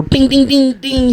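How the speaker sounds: high-pitched ringing dings; rapid-fire chiming imitation; imitated Sonic ring sounds. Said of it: "Making it sound like Sonic in here".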